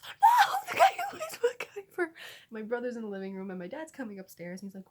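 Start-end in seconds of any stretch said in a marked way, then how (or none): 0.0-2.1 very high, very loud squealed correction; frantic excited shriek. Retold or imitated. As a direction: shriek it in re-enacted exasperated excitement. Acting frantic correction of mom's guess.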